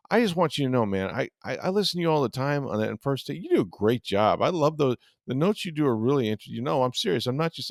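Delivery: a hint of a sincere, upbeat Tirico; earnest Tirico impression. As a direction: play the kind broadcaster gushing sincerely. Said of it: "He goes".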